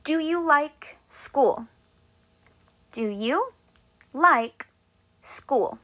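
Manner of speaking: slow, drawn-out question; teacher modelling a question; slow model question. Shown as a demonstration. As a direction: ask it slowly and clearly. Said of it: "Let's say I wanna ask you a question".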